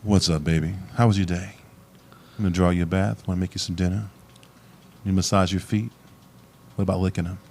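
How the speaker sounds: low, soft pillow talk; bedroom voice. Shown as a demonstration. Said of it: "Tell us, give us a little example".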